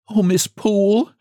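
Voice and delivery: high-pitched, acted distress; sobbing. A narrator's reading. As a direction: plead tearfully in a high voice. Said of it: "sobbed she".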